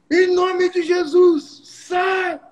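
high, slow, drawn-out command; mock authority. Young preacher ordering the demon out, timid, high and slow. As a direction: stretch the words out like a timid exorcist. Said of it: "fala com autoridade com esse capeta aí".

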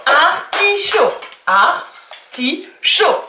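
"Artichaut" stretched slowly as ar-ti-chaut; syllables drawn out. Shown as a demonstration. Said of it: "le che dans la troisième syllabe".

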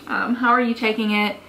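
normal talking voice

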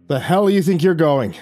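Johnny confronts the captain, loud and angry; angry retort. Acted. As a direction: bark it at him in disbelief. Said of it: "Johnny frowned".

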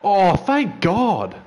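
high, loud and slow relief; relieved shout. Real, not acted. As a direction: let the relief burst out. Relief after goal stands.

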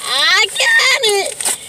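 slow, high, drawn-out gloating; excited drawn-out boast; triumphant. Real, not acted. Triumphant reveal.

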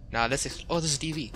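ordinary talk, nothing marked

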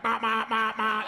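high, repeated "ma" stammer; mock a stammer as a stuck, repeating noise; mocking stutter. Comic roasts the heckler to the club, mock stuttering. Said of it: "he's driving the little yellow bus".

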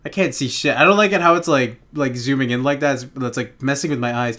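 ordinary talk, nothing marked

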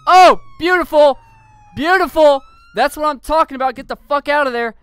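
high, loud thrilled shout; excited cheering. Real, not acted. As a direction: whoop at the perfect hit. Successful pit maneuver.

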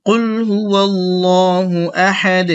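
slow, drawn-out tajweed recitation; slow Quran recitation. Shown as a demonstration. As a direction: recite slowly so they can repeat. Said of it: "আমার সাথে আপনারা একটু বলার চেষ্টা করুন".

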